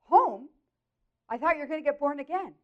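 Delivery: loud, high outburst of disbelief; incredulous. Retold or imitated. Wife hearing husband skipped getting born again, incredulous. Wife dismayed he is coming home.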